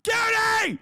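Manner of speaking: high shouted mock fury; playful angry yell. Real, not acted. Streamer yelling at Cutie for telling the truth. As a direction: yell at a friend who sold you out.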